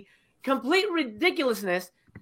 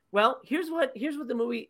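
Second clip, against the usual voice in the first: ordinary talk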